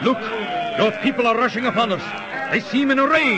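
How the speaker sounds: high, fast alarmed cry. Acted. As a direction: sound the alarm as they rush in. Mob storms the couple.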